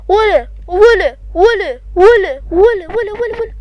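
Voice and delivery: loud, high, drawn-out yell; shouting at someone. Real, not acted. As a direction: holler after him to stop. Yelling at fleeing criminal. (صراخ عالي وممدود)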